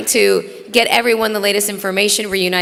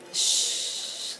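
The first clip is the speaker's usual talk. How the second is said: soft shush; 12 dB quieter than usual. Real, not acted. Shushing the noisy crowd.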